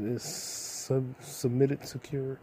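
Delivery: normal talking voice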